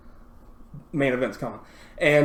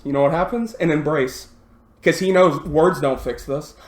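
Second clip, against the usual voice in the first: ordinary talk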